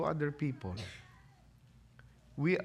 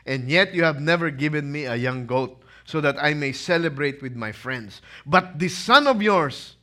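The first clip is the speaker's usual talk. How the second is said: put-on resentful older brother; 2 semitones higher, 9 dB louder than usual. Older son shouting his grievance at his father. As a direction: rant at the father like the wronged elder son.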